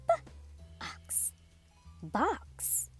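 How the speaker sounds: ordinary delivery, nothing marked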